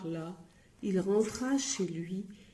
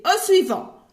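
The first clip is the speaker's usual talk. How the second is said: much higher, loud call; shouted next; 10 semitones higher, 11 dB louder than usual. Acted. Grocer shouting to dismiss Monsieur Silence. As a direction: bellow for the next customer.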